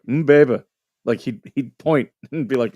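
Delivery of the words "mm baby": imitating kid brother. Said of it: "And he had such a low voice".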